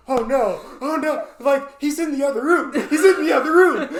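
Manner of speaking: loud, high panicked whisper-shout; frantic friend in a panic; loud high mock panic, laughing. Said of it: "[name] is now going".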